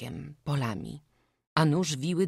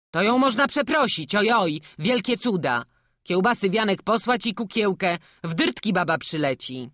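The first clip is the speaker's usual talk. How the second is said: loud, higher-pitched retort; confident village gossip; 6 semitones higher, 7 dB louder than usual. Acted. Villager suggests winning the woman over with sausage, loud retort. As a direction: brush the problem aside loudly.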